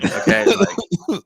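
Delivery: slow, high mock brush-off; hammed-up okay; exaggerated dismissal. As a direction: drag it out, high and loud, bored. Mock disinterest, drawn out.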